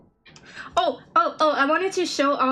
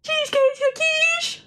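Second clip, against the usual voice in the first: put-on high, squeaky girlish voice; cutesy girl voice. Streamer trying out a girl voice, high and squeaky. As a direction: chirp it in a tiny cartoon-girl voice.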